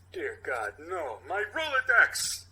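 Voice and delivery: building to a loud, slow anguished cry; dismay swelling into a howl. Acted. Character dismayed discovery.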